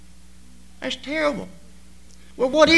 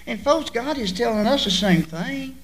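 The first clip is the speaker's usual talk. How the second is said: ordinary talk, nothing marked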